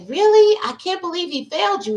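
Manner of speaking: high disbelieving really; retold high disbelief; incredulous friends. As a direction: sound shocked on her behalf. Friends consoling in parking lot.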